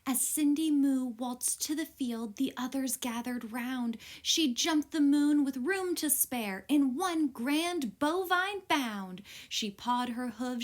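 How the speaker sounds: ordinary delivery, nothing marked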